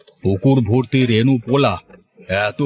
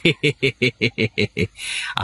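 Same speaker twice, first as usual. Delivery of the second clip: amused laugh. Acted. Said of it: "তুমি হাসছো কেন?".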